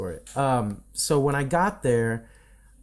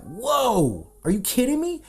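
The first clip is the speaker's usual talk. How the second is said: much higher, louder whoa of amazement; excited disbelief; 11 semitones higher, 3 dB louder, slower than usual. Retold or imitated. Retelling his reaction to a big client signing, excited and high. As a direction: blurt it out, thrilled. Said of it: "I was like".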